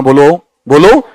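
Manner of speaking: ordinary talk, nothing marked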